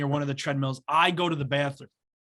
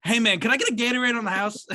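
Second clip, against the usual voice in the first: quick, higher mock plea; imitating the creep begging a free Gatorade; weird customer voice; 6 semitones higher, 3 dB louder, faster than usual. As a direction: rattle it off high like the odd guy. Strange guy asking free drink.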